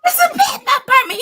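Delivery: high, rushed cry for help; hammed-up frantic phone call; panicked plea. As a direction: beg fast in a squealing voice. Retold panicked call to brother.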